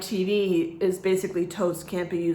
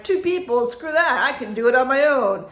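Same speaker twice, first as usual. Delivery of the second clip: higher, incredulous echo, then dismissive; defiant scoff; 4 semitones higher, 4 dB louder than usual. Retold or imitated. Vlogger retelling her reaction to the credenza box, defiant. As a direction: scoff at the very idea.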